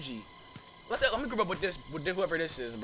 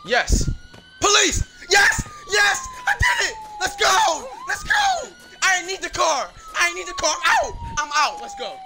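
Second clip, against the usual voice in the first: much higher, louder whoops of triumph; victory shouting; 4 dB louder than usual. Real, not acted. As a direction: scream with joy after a narrow escape. Escaped, celebrating the win.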